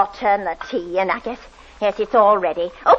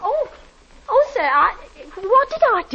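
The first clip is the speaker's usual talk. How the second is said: alarmed squeak, much higher; startled and scared, voice jumping up; frightened, pitched up; 12 semitones higher than usual. Acted. Landlady caught humming, rebuked by her lodger, frightened and high.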